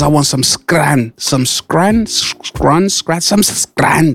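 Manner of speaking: slow Scouse voice drawn out on scran; imitating the Scouse man asking for scran, in a slow Scouse accent. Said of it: "He says".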